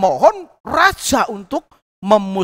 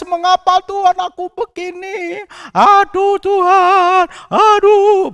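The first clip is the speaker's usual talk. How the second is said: higher, louder crying plea to God; acted weeping lament; 7 semitones higher, 4 dB louder than usual. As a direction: lament like a man in despair. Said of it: "Mungkin dia menangis".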